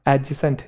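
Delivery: "एडजेसेंट" with no D before J; silent D. Shown as a demonstration. Teacher repeating the correction, "एडजेसेंट" with silent D. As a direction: say "एडजेसेंट" as a-je-sent. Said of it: "जो डी है वो silent है".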